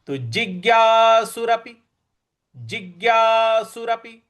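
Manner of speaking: जिज्ञासुरपि with ज्ञ hit hard and high; teacher stressing the conjunct. Shown as a demonstration. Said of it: "इस पर हमको आघात देना है".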